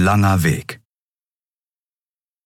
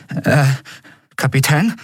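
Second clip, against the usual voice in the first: hesitant, high-pitched query; falter, then ask with a rise; nervous; 7 semitones higher than usual. A narrator's reading. Miko to his captain in the corridor, nervous. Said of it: "Miko sah sich nervös um".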